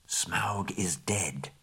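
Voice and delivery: soft, slow unvoiced whisper. Birds passing the tidings over Mirkwood, whispered. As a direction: breathe the news like a rumour.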